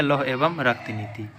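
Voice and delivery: ordinary delivery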